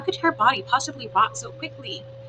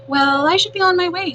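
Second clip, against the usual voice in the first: opossum voice; 8 semitones higher, 7 dB louder than usual. Acted.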